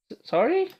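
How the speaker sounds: high, startled squeak. Acted. Ada cut off by Martha, startled. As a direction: caught off guard.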